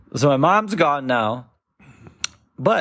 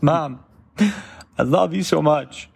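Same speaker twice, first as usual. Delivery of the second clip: tearful goodbye. Retold or imitated. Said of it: "I would look at my mom and say".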